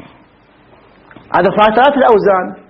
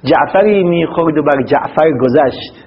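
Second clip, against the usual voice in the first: slow poem recital; 4 semitones lower, slower than usual. Shown as a demonstration. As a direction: recite the Persian riddle verse slowly. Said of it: "هذا من لغز". (تلاوة بطيئة لبيت الشعر)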